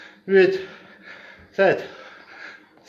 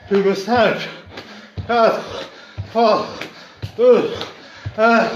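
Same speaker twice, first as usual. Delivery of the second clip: shouting encouragement. Real, not acted. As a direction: push them through the last reps. Counting down final reps loudly.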